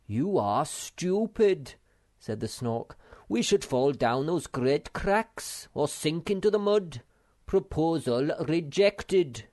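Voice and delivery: chair the meeting with contempt; scornful Snork. Acted. Self-important chairman scolds friend, loud and bossy.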